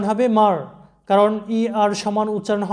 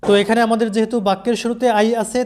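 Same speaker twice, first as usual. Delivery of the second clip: ordinary delivery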